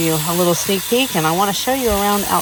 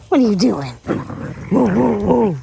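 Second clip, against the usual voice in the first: pet talk; 4 semitones higher than usual. Real, not acted. Owner calling to her puppy Kaya in high pet talk.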